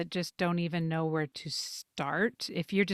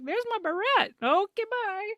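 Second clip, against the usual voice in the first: bright high-pitched self-quote; high chirpy quote; 11 semitones higher than usual. Retold or imitated. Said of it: "I'd go back".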